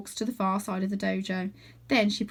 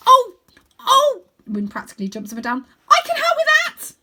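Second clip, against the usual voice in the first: put-on much higher, louder excited boy; eager shout; 11 dB louder than usual. A narrator's reading. Win jumps up volunteering, squealing with excitement. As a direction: burst out with eagerness.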